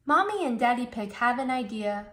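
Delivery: ordinary delivery, nothing marked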